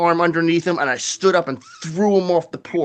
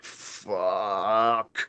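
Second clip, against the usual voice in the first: muttered curse; 8 semitones lower, 10 dB quieter than usual. Retold or imitated. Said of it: "I'm like".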